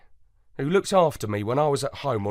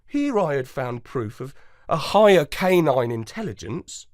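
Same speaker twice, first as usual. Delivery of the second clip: plain reading voice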